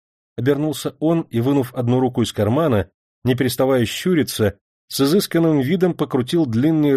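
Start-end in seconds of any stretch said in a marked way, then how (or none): none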